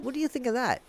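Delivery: hushed, quick drop to an undertone; quiet aside. Pretend. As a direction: tuck it in very softly. Quiet aside after each sung line.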